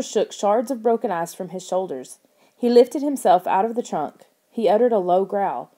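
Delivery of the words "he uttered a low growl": ordinary delivery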